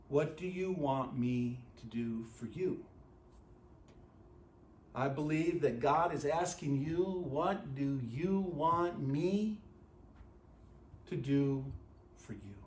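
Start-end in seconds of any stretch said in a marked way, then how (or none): 0.1-2.8 soft, quiet and intimate; tender question. Acted. Jesus asking the listener directly, soft and tender. 7.0-12.5 very slow, soft asking; gentle question. Acted. God asking the listener personally, slow and soft.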